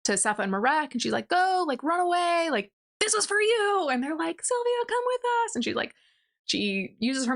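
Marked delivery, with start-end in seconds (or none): 1.3-3.9 acted urgency, pitched very high; urgent plea. Sylvia tells Sefa and Marek to run, urgently. Sylvia urges friends to flee. 4.4-5.5 very high, mock-urgent plea; pleading call. Sefa and Marek beg Sylvia to come, pleading. Friends plead as hall collapses.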